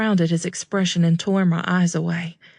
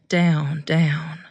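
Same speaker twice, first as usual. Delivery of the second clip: plain reading voice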